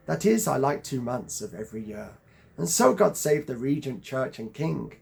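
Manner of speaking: emphatic, measured verse recital; poetry reading. Acted. Reader reciting Byron's list of likes to viewers, declaimed emphatically. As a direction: declaim each line, leaning on the stresses. Reads a Byron stanza aloud.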